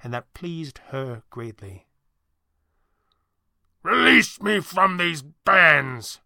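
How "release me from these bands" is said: loud, slow, high shriek; a bound villain shrieking at his captor; shrieking demand. Acted. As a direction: shriek it out, dragging each word. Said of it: "Thatcher shrieked at Falcone".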